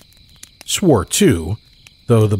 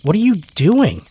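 put-on high insistent child's voice; child demanding; 11 semitones higher than usual. Child demands again of woodcutter, high and insistent. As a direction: press the question like a stubborn child. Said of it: "they demanded again".

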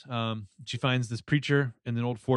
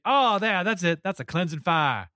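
higher, louder Southern preacher's relish; Southern accent; 6 semitones higher, 5 dB louder than usual. Retold or imitated. Host voicing the villain gets teased for her Southern accent. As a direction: savour it in a hammed-up Southern accent. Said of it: "Your Southern accent's coming out".

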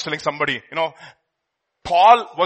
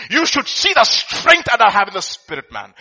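normal talking voice

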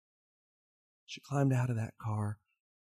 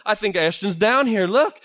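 high, loud urging; Billy shouting for the miners to look; loud insistence; 9 semitones higher, 11 dB louder than usual. Acted. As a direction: make them look.

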